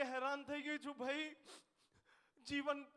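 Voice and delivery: high, wailing woman's lament; mock crying. Weeping woman complains to the man surveying her, mock crying. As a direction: wail it with comic despair. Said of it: "એક બેન રડતા હતા".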